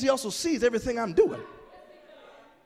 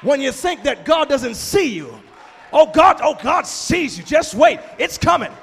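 much louder, higher shouted warning; fiery preaching; 5 semitones higher, 10 dB louder, slower than usual. Real, not acted. Preacher roaring at the congregation that God sees. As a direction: build to a roaring climax.

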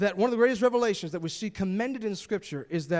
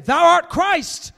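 louder and higher, slow and drawn out; devils crying out; 5 semitones higher, 7 dB louder, slower than usual. A narrator's reading. Preacher reading the devils crying out to Jesus, loud and slow. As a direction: cry it out slowly, like a voice from a possessed man. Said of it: "crying out, saying".

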